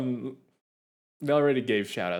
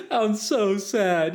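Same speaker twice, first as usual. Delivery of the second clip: mock sad; 7 semitones higher, 3 dB louder, much slower than usual. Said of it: "I'm crying inside".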